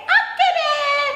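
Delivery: high, cheery puppet voice; chirpy child puppet saying hello; puppet girl Tiur. Acted. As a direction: greet the kids as the little puppet.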